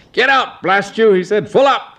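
put-on high, barking old earl; gruff old earl. Old Earl ordering Adrian out, high barking voice. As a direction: snap him out of the carriage.

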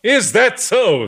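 slow, high, loud, ringing challenge; vibrating voice. Acted. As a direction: let it ring through the room. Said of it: "speaking in a voice that vibrated through the room".

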